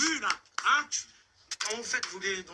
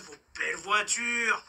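ordinary delivery

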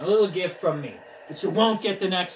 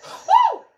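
very high, loud "woo"; piercing falsetto whoop; 11 dB louder than usual. Real, not acted. Player celebrates a pitch, high whoop.

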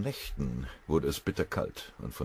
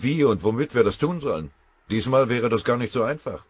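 loud, higher and urgent general; commanding general; 7 semitones higher, 11 dB louder than usual. Acted. General tells his chief of staff the flank is in danger, loudly. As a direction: hammer out the worries about the flank. General worries about Romanians.

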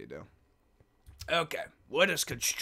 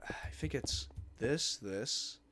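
usual speaking voice

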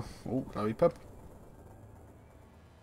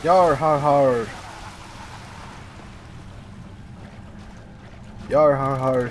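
pirate laugh. Pretend. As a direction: belt it like a cartoon pirate. Pirate laugh while fighting pirate ghost.

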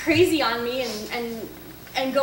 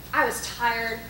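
ordinary talk, nothing marked

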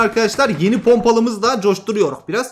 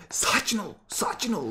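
hushed self-calming; 11 dB quieter than usual. Real, not acted. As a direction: settle yourself down under your breath. Calming himself during tense gunfight.

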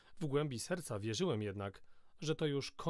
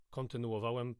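ordinary delivery, nothing marked

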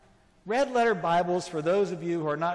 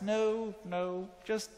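ordinary talk, nothing marked

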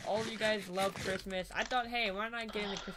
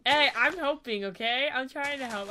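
defensive; 4 semitones higher, 8 dB louder than usual. Real, not acted. Defending herself, teasing.

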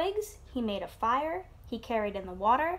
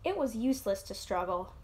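ordinary delivery, nothing marked

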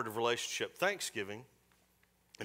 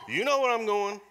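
ordinary talk, nothing marked

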